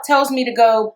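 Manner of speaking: ordinary talk, nothing marked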